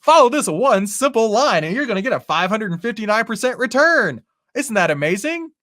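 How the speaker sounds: over-the-top ad announcer; YouTube ad voice. Retold or imitated. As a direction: sell it like a cheesy online ad. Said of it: "my best impression of a, a YouTube ad".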